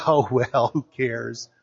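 preacher mocking an indifferent God, low and trailing; mock shrug. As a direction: toss it off like nothing matters.

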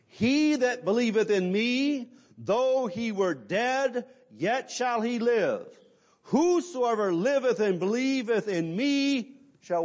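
high, slow, declamatory verse reading; solemn scripture proclamation. Acted. Preacher quoting Jesus on the resurrection, solemn scripture proclamation. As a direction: proclaim each phrase high and drawn out.

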